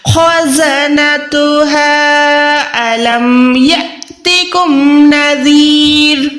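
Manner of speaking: slow drawn-out recitation; Quran recitation. Shown as a demonstration. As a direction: chant it slowly with long vowels. (धीमी तिलावत)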